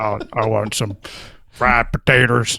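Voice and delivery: put-on Southern accent. Echoes chicken fried taters joke.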